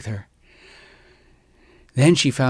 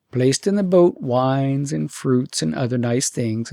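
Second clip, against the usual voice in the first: ordinary delivery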